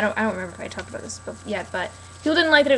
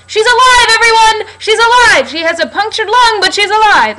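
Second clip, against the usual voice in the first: very loud, much higher hammed-up bellow; hammed-up cop yell; 11 semitones higher, 13 dB louder than usual. Fan mocking the cop, yelling the news into the woods. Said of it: "what kind of cop yells out into the woods".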